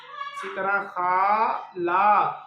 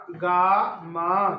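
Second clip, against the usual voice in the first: Gamma stretched very slowly and louder, each syllable held; slow teaching take of gamma, with the quick take after it; drawn-out demo; 6 dB louder, much slower than usual. Shown as a demonstration. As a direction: draw out gamma so a child hears each sound. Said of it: "teacher demonstrates word twice".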